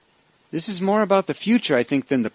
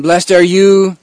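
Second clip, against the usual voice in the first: louder, rising blessing that hooks the listeners; playing to the crowd; 3 semitones higher, 8 dB louder than usual. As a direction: call it out to draw the crowd in. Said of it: "he was actually playing with the crowd".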